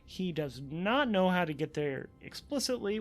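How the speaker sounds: ordinary talk, nothing marked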